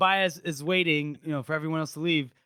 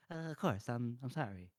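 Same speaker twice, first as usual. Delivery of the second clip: cowed mumbling apology; 6 semitones lower, 13 dB quieter than usual. Retold or imitated. King cowed by advisor.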